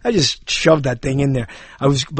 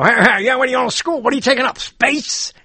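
high, hammed-up teasing uncle; mock an uncle ribbing a kid; corny uncle joke; 6 semitones higher than usual. Uncle teasing young nephew about school, higher hammed-up voice. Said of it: "Uncles used to go".

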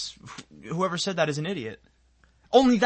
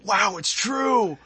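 drawn-out, higher-pitched gullible wonder; mock amazement; 6 semitones higher, much slower than usual. Tourist watching a toilet flush, slow mock amazement. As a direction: marvel slowly, like a gullible believer. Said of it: "and they go".

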